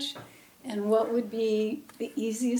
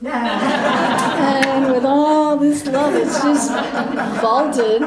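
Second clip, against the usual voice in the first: laughing; 3 semitones higher, 8 dB louder, much slower than usual. Real, not acted. Joking about love vaulting ceiling.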